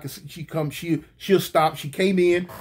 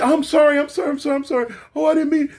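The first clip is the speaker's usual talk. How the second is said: high, rapid-fire repeated apology; mimicked flustered apology; woman apologizing frantically; 8 semitones higher, faster than usual. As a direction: gush sorries in a high voice. Voices the woman apologizing again.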